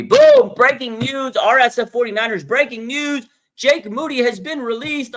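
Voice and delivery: high, loud mock news alert; breaking news. Fan imagining the team releasing the kicker, loud mock news alert. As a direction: announce it like a hammed-up news flash.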